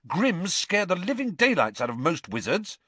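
high, heated insistence; starting to get angry. A narrator's reading. Boy defending fear of omens, starting to get angry. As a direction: argue back with rising irritation. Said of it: "starting to get angry".

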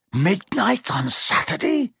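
high, slow, hoarse alarm. A narrator's reading. Ron croaking in alarm at the news, hoarse and slow. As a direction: croak it out in panic, slowly. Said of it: "he said in a hoarse voice".

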